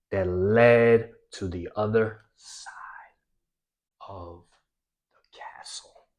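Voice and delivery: whisper. Shown as a demonstration. Whispering the castle part to draw the listeners in. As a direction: drop to a hushed whisper. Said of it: "learn to raise your voice".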